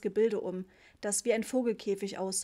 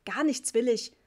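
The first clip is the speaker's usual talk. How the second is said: loud, indignant outburst; worked-up; 3 semitones higher, 6 dB louder than usual. A narrator's reading. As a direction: call it out, refusing angrily. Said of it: "rief Papa aufgebracht". (aufgebracht gerufen)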